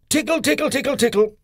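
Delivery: high, squeaky tickle tickle; playful tickle. A narrator's reading. Mister Tickle tickling the postman, high and playful.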